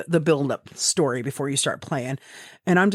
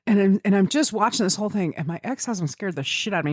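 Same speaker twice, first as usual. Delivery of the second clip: usual speaking voice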